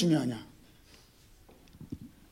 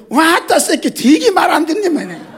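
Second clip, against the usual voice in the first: mock-gruff Jeolla GPS, higher and louder, laughing; rough Jeolla dialect scolding; 7 semitones higher, 4 dB louder than usual. Preacher imitating a scolding Jeolla GPS to the congregation, mock-gruff Jeolla accent. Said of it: "또 전라도로 넘어갈 때".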